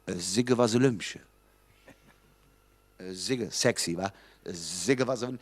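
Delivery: "Sigge was Lümsche" said slow and low in broad Kölsch; Kölsch translation demo. Shown as a demonstration. Comedian teaching audience, slow low Kölsch demo. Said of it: "Ist ganz einfach".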